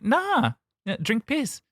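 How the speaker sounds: high, breezy casual offer; a light mock New Zealand accent; imitating a Kiwi friend. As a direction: toss it off like a local. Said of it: "And she's like".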